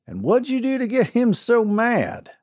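a wry frontier sheriff, amused. A narrator's reading. Sheriff voiced in story.

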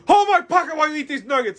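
loud, very high mock yell; aggressive. Drive-thru worker bullies a customer, aggressive yell. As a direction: yell it like a bullying clerk. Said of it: "they're all aggressive".

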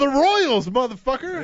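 high, loud gleeful yell; excited cheer. Real, not acted. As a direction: whoop it out like a fan celebrating. Cheering his baseball team.

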